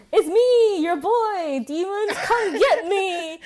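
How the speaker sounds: high, laughing mock taunt at demons; cocky ghost-baiting call, half laughing; taunting skeptic. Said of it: "the skeptic ... goes".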